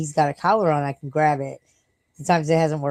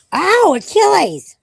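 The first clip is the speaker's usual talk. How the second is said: much higher, louder, drawn-out cry; pained yell at the dog; 9 dB louder, much slower than usual. Real, not acted. Owner hurt by her dog, real loud cry. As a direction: yelp at the dog who hurt you.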